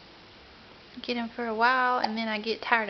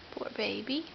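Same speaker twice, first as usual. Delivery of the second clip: ordinary delivery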